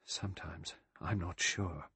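hushed, slow and doubtful; low voice. A narrator's reading. Vera admits doubt to Lombard in a low voice. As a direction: say it softly, taking your time. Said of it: "said in a low voice".

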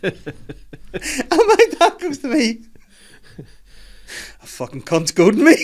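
high voice strained by laughing; cracking up mid-story; real helpless laughter. Real, not acted. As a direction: squeeze the words out through laughter. Cracking up while telling the story.

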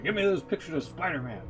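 normal talking voice

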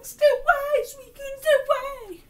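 very high, loud panicked cry; hammed-up frightened shriek; mock terrified pleading. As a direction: beg it to stay back. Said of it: "was going".

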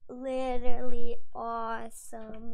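loud, slow, stretched-out delight; loud, slow cry of joy; thrilled shout. Real, not acted. Thrilled at getting the pet.

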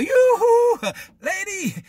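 high, loud comic yoo-hoo; calling out. Acted. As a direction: hail her playfully from across the room. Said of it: "Jesus saw her and called her".

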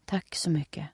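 stiff soft whisper. A narrator's reading. Steffi thanks tant Märta in a stiff whisper. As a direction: whisper it through stiff lips.

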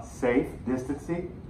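ordinary delivery

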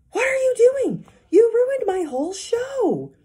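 very high, loud, slow outraged cry; upset outburst. Acted. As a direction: wail at the hamster who ruined it. Magician upset at hamster.

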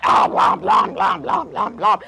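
sloppy lion munching noises; exaggerated messy-eater sounds; noisy gobbling. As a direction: gobble it down in repeated chomps. Acting out the lion gobbling food.

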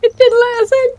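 talking through loud laughter; laughing hard. Real, not acted. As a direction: crack up while speaking. Boat bounced off the gate.

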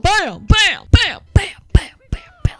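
loud bams climbing in pitch; imitated impact noises. Gamer bouncing on enemies, loud rising bams. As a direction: fire off rising cartoon bams.

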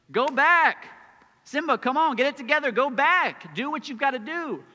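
high, loud pushy father shouting him back; hammed-up nagging dad yelling orders; mock urgent command. Pastor voicing a pushy Mufasa to Simba, mock loud command. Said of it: "He doesn't say that".

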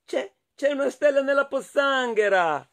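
high-pitched excited wonder; amazed hedgehog. Acted. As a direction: exclaim it in amazement, voice lifted high. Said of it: "mormorò Riccio". (stupore acuto del riccio)

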